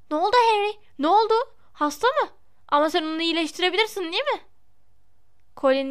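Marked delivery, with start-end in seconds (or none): 0.1-4.4 high-pitched, eager questioning; much higher, excitable young fan; excited boy. A narrator's reading. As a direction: fire off questions breathlessly keen. Eager boy runs up asking questions.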